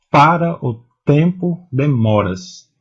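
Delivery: "para o tempo demoras" read slowly with the stressed syllables marked. Shown as a demonstration. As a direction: read the verse slowly, hitting each tonic syllable. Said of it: "E não há". (leitura lenta, marcando as tônicas)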